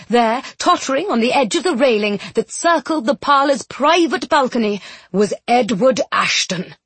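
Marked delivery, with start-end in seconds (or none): none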